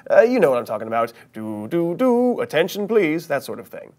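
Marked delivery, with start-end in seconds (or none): 1.3-3.3 sung chime, then a drawn-out announcement; mock PA preamble. Shown as a demonstration. Showing learners a familiar page preamble, mock PA announcement. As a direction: imitate the PA tone, slow and higher. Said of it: "some sort of musical tone to announce".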